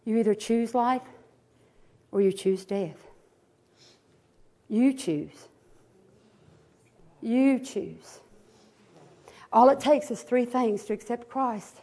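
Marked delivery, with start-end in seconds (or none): 0.0-8.2 low, soft, with long pauses; hushed, weighty altar appeal; solemn appeal. Real, not acted. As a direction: lower the voice and let each choice hang.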